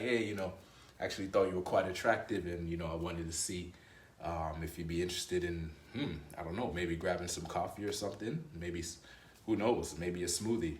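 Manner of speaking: quiet, low, unhurried ask-out; easygoing charm, like a secure king; relaxed confident invite. Retold or imitated. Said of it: "a king that knows his value".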